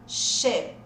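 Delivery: Sheep said short, like "shep" with closed ê; short closed vowel. Shown as a demonstration. Teacher lets students check the second word, short ê vowel. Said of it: "Coloca o som de E".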